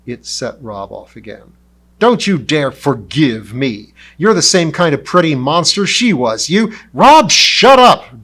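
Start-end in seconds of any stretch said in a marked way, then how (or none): 2.0-6.7 furious and hurt, yelling; enraged outburst. Acted. 6.9-8.2 slow, very high and loud roar; furious commanding roar. Acted. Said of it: "Doug roared".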